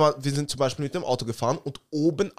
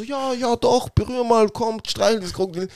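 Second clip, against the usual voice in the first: higher, eager coaxing voices; coaxing crowd; 6 semitones higher than usual. Retold or imitated. Speaker imitating everyone urging him to pet the crocodile, higher and eager. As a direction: egg him on like the whole crowd. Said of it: "Und dann alle so".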